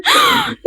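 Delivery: sharp high gasp of fright; sudden frightened intake of breath; startled gasp. Real, not acted. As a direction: gasp as the jump scare hits. Sudden scare in horror game.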